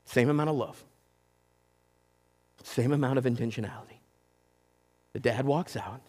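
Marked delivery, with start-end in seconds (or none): none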